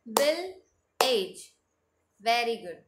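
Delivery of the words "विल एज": विल एज with a long gap, falling on एज; two-beat syllables. Shown as a demonstration. Teacher leading two-clap drill, विल एज split apart. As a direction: separate विल and एज into two beats. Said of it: "हमें टू times clap करनी है".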